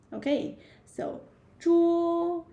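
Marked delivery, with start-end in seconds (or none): 1.6-2.5 桌 high, level and drawn out; first tone. Shown as a demonstration. Teacher isolating the syllable for learners, 桌 in first tone. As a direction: hold 桌 on one high flat note. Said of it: "yeah, first tone".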